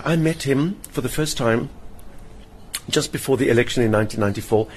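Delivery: ordinary talk, nothing marked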